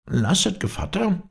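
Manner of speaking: high, plaintive fox sigh. A narrator's reading. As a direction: sigh it out pleadingly. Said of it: "seufzte der Fuchs".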